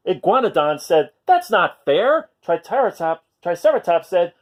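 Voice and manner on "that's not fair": high, slow whiny complaint; protesting. A narrator's reading. Iguanodon protesting to the bully, high and whiny. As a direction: whine it out slowly.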